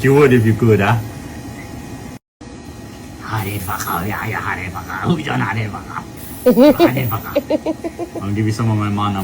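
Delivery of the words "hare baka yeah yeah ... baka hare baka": secretive whisper; hushed teasing. Real, not acted. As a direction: tease under your breath. Playful whispered teasing phrase.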